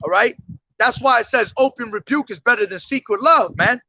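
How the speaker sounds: normal talking voice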